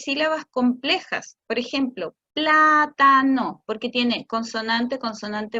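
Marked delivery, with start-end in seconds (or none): 2.3-3.6 plátano stretched slowly, syllable by syllable and louder; pla-ta-no drawn out, louder; slow segmented word. Shown as a demonstration. Therapist showing parents a hard word, slow and segmented. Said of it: "decirlas un poco más lentas y segmentadas en sílabas".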